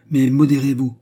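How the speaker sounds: insistent warning; urgent admonishment. A narrator's reading. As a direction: rein him in sharply. Companion urgently restrains excited man.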